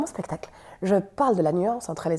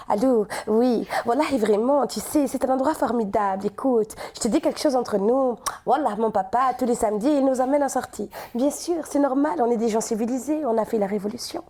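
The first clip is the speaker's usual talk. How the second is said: put-on sing-song Tunisian accent, fairly loud; chatty Tunisian lilt, confiding with gusto; 5 dB louder than usual. Comedian shows how Tunisians talk, sing-song Tunisian accent. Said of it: "ils ont tendance à chanter un peu".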